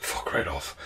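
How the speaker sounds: whispered disbelief. Retold or imitated. Reaction to the price.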